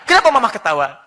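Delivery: loud, high indignant question; puzzled husband. Retold or imitated. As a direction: voice a baffled husband demanding an answer. Husband asking why wife laughs.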